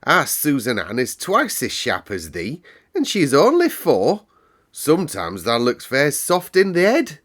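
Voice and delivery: a Yorkshire girl mocking Mary's softness; scolding Yorkshire maid. A narrator's reading. Martha scolds Mary.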